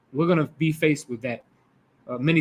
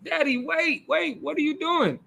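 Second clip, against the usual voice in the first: put-on much higher, a young boy calling his father back; pleading little boy; 10 semitones higher, 4 dB quieter than usual. The boy calling after his father, higher and pleading. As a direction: plead like a confused child.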